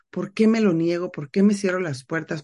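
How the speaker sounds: normal talking voice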